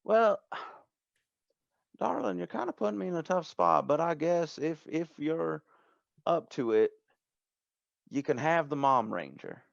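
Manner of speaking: sigh, then give in hesitantly; high folksy voice easing down as it relents; reluctant shop owner. Acted. Game master plays Denise relenting.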